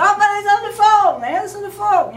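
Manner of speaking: loud, high, fast yell; yelling through the house. Retold or imitated. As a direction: shout the order across the house.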